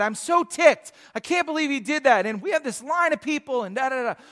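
mock exasperated griping; imitated frustration. Barista complaining with a line waiting, imitated frustration. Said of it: "All this just frustration".

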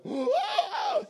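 mock gasp. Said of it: "you'll go".

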